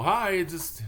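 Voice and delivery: high, cheery hi; upbeat quote. Retold or imitated. Quoting an upbeat person's answer, cheery. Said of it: "response I get from people who are upbeat".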